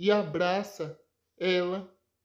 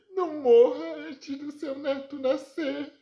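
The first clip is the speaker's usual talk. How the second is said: higher, sobbing plea to a dying grandmother; crying plea; 8 semitones higher, 3 dB louder than usual. Acted. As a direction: beg her to hold on, crying. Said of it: "Sandra chora e diz". (neta implorando chorando à avó moribunda)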